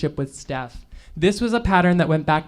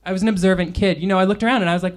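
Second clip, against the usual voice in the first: plain reading voice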